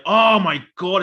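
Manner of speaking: mock amazed outburst. Viewer reacting to the synced-up clips, mock amazed. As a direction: play up the astonishment.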